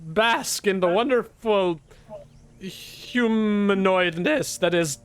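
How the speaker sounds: high, slow, self-important drawl; preening high-pitched humanoid; Tech's haughty voice. Acted. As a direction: grandly offer your services. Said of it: "And she goes".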